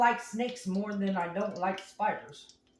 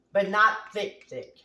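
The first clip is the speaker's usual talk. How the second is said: "thick" doubled and pressed louder; stressing thick; 2 semitones lower, 4 dB louder than usual. Shown as a demonstration. Cook explaining potato slices, stressing "thick". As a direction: lean on "thick" to show how thick. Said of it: "a little thick".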